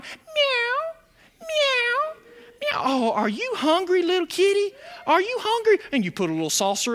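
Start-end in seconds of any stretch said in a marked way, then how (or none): 0.0-2.8 high-pitched pleading cat meow; kitty meow. Retold or imitated. Scrawny kitty begging on the back porch, high imitated meow. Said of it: "looking at you with those sweet big eyes going". 2.8-5.8 put-on high, soft baby talk to a pet; cooing to kitty. Homeowner to a stray kitty on the porch, high cooing.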